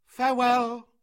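high, very loud goodbye; calling out. A narrator's reading. Mowgli bidding Meswa farewell, calling out. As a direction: call it across the distance.

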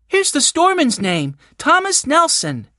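put-on high-pitched young Benny calling out a discovery; excited cry. A narrator's reading. Benny spots the storeman's name, crying out excitedly. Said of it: "cried Benny".

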